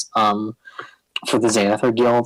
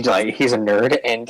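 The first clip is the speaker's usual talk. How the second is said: ordinary talk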